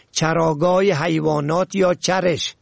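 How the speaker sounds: ordinary delivery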